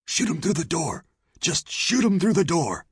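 high, urgent, panicky voice; frightened saloon owner. Acted. Saloon owner urges lawmen to shoot, panicky.

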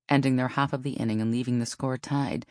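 ordinary delivery

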